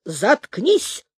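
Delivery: curt bark, without full volume; barked order. A narrator's reading. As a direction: cut the recruit off roughly. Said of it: "гаркнул Дурман". (резкий окрик генерала)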